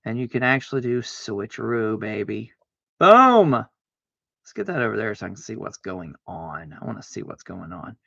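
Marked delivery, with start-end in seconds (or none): 3.0-3.5 loud, high victory shout; triumphant boom. Real, not acted. Playing a card with a loud triumphant boom. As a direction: slam it down with a shout.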